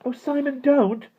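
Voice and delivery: high, upset plea; distressed girl pleading; unhappy pleading. A narrator's reading. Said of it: "said Jane unhappily".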